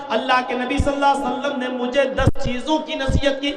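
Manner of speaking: usual speaking voice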